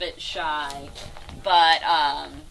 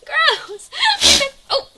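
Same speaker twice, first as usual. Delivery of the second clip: much higher, louder squealing laugh; grossed-out squeal; 10 dB louder, much slower than usual. Real, not acted. Keeper squealing as a goat's pee splashes her. Goat peeing, splashing them.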